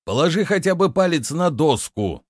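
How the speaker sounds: high, hurried-sounding interjection; urgent exclamation. A narrator's reading. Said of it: "воскликнул травник". (взволнованный возглас)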